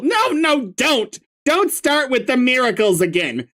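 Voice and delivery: sarcastic grumpy troll. Acted. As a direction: spit sarcasm, voice pitched high.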